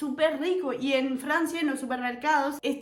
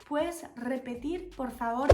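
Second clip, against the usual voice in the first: pidiendo repetir tímida; 8 dB quieter than usual. Retold or imitated. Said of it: "yo estaba".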